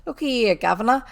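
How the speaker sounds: a touch of a fast pleading Cockney; pleading burglar. A narrator's reading. Burglar begging the robber, lightly fast pleading. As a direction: hurry to talk his way out. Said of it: "began to speak very fast".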